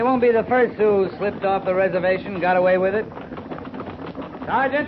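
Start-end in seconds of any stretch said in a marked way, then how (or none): 4.5-4.9 high call across the camp. Acted. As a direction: sing out a rank to summon him. Captain calls out to sergeant.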